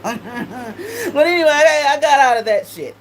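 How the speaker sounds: laughing hard, slowed, words breaking up; real laughter spilling into the line. Real, not acted. Laughing through the memory.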